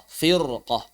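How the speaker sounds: FIRQAH with a heavy, dark r; thick r. Shown as a demonstration. Teacher confirms the correct reading, thick r. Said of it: "Maka kita baca firqah".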